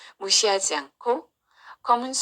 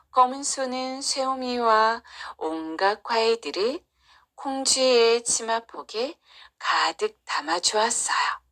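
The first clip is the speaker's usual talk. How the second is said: ordinary delivery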